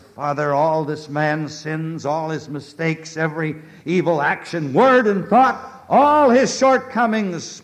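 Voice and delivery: fervent advocate's climb; pleading intercession. A narrator's reading. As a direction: plead with the Father, swelling each item. Christ intercedes before the Father.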